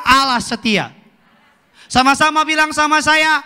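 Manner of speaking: high, ringing preacher call; fervent pulpit cadence; full-force preaching. Acted. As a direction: rouse the congregation to answer.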